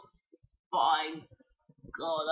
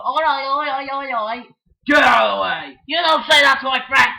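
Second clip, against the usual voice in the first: loud, crying-out yell; protective shout; 6 semitones higher, 12 dB louder than usual. Pretend. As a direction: defend your friend at full volume. Toy hero defends friend.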